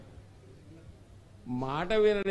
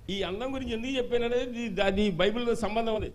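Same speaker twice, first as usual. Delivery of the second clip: ordinary talk, nothing marked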